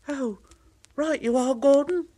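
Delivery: high, cheerful little engine; eager little engine. Acted. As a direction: agree brightly and high. Thomas agreeing.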